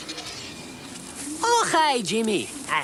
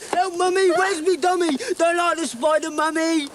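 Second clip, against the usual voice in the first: put-on higher babyish whimper; babyish whine; 7 semitones higher than usual. King whines to his mummy, babyish.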